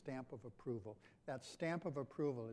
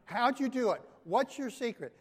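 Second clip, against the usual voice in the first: mock eager question; 8 semitones higher, 11 dB louder than usual. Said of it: "As if they did it".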